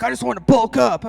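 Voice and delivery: a lightly voiced gym bro; guy voice. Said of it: "they're like".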